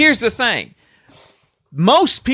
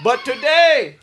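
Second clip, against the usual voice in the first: mock war shout, pitched up; hammed-up movie speech yell; rousing battle cry; 10 semitones higher, slower than usual. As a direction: roar it to the army. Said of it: "I know this whole speech".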